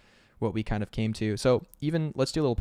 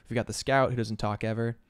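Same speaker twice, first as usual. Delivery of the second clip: usual speaking voice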